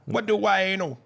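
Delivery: high, loud Jamaican "What do I know?"; Jamaican accent. Shown as a demonstration. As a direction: redo the line in a Jamaican accent. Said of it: "in a Jamaican accent".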